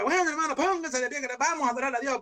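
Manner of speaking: imitating a pastor opening the service; mock pastor. Preacher mimicked rousing the congregation to stand and worship. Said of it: "y empieza el pastor, va".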